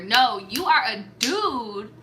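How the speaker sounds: usual speaking voice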